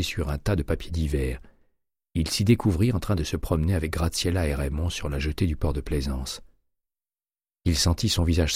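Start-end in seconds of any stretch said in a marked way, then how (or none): none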